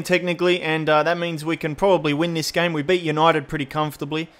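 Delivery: ordinary delivery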